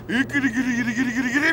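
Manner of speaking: rapid rolling "gri-gri" shudder noise; imitated shivering. Shown as a demonstration. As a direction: mimic a trembling body with repeated gri.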